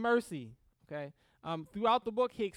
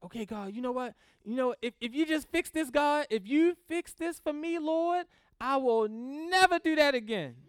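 lightly pleading, rising louder toward the end; mock bargaining prayer; 4 semitones higher, 4 dB louder than usual. Preacher mimics a believer bargaining with God, mock pleading. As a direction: bargain with God like a sheepish child. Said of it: "bring that right over to God too".

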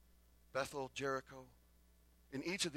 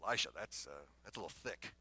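low, taken-aback protest trailing softer; understated shock; 8 semitones lower, 5 dB quieter than usual. Acted. Elijah balking at Elisha's huge request, quietly shocked. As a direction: back off from the request in a lowered voice. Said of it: "he's shocked and he goes".

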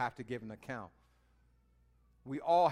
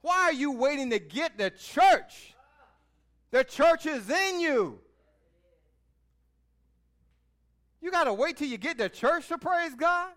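loud, higher, driving sermon; fiery preaching; 10 semitones higher, 10 dB louder than usual. Acted. Preacher challenging the congregation about praise, loud and fiery. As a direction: preach it hard at the crowd.

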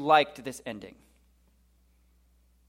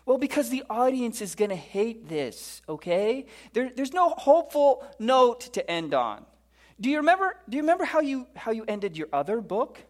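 mock-exasperated editor, pitch climbing; 6 semitones higher than usual. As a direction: plead with a stubborn writer. Panel member argues with Jeremiah.

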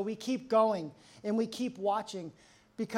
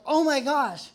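loud, higher burst of sudden realization; amazed exclamation; 4 semitones higher, 8 dB louder than usual. Acted. Counselor suddenly seeing themselves in it, acted amazed outburst. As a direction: blurt it out in acted astonishment.

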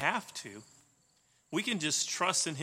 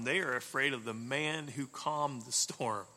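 normal talking voice

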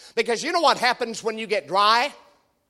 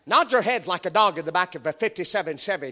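normal talking voice

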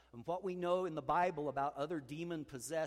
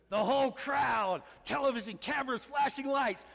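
triumphant shout; 10 semitones higher, 10 dB louder than usual. Acted. Preacher voicing the stadium's roar, loud and high. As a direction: proclaim the roar of the crowd.

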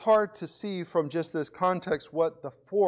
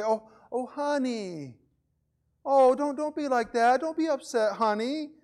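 put-on high-pitched, whiny pleading with an upset child; whining mother; 7 semitones higher than usual. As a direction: plead like a whiny mom soothing her kid. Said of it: "kinda doing this whining thing".

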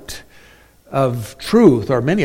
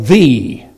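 "the" said loud and firm; emphatic "the"; stressed article; 4 semitones lower, 7 dB louder than usual. Shown as a demonstration. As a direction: hit "the" hard, as a definite article. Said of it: "the definite article".

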